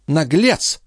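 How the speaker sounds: high, cross insult; indignant countess. A narrator's reading.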